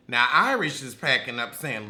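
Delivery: ordinary delivery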